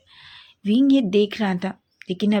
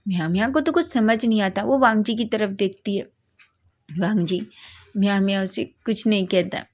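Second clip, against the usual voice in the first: usual speaking voice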